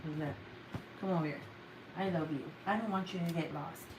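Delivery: soft, low murmur to a pet; tender coaxing. Real, not acted. Owner to cat after telling off, tender coaxing. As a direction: make up gently after scolding.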